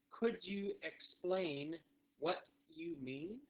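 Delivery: very slow, rising on each half; drawn-out, spaced question; slow phrase model. Shown as a demonstration. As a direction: stretch it word by word for learners. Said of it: "One more time".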